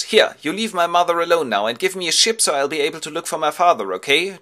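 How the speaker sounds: put-on high, loud boyish order; young son's demand. Telemachos confronts the suitors, high loud demand. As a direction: stand up to them, high and loud. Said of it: "turns around to the suitors and goes".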